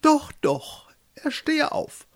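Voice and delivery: very high, tentative regal voice; hesitant. A narrator's reading. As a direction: concede it reluctantly from up high. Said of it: "sie meinte zögerlich". (zögerlich, mit sehr hoher Stimme)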